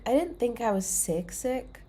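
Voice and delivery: ordinary talk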